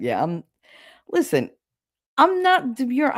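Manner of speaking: ordinary talk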